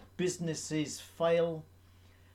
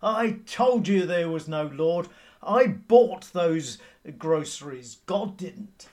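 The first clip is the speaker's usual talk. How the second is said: gloating neighbour; 2 semitones higher, 8 dB louder than usual. Retold or imitated. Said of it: "jumped out from behind a bush and said".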